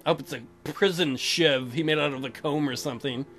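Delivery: usual speaking voice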